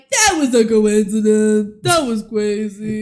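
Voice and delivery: mocking parents. Parents dismissing Scott's claims.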